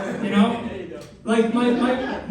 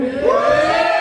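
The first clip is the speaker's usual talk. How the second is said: excited whoop; 4 dB louder than usual. Real, not acted. Crowd weed smokers cheer.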